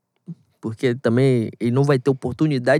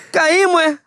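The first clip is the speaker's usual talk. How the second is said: laughing; 14 semitones higher, 4 dB louder, slower than usual. Real, not acted. Joking about River losing.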